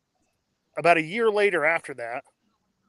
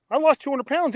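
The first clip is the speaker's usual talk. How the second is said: high, proud burst; proud exclaim; 9 semitones higher, 4 dB louder than usual. Real, not acted. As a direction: announce it with pride. Repeats weight loss, proud.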